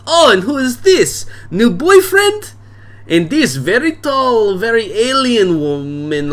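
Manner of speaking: put-on high, slow, booming foreign accent; boisterous bar owner. Drunk dad welcoming daughter's friends to his bar, booming. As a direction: play the drunk dad greeting guests expansively. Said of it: "and he says".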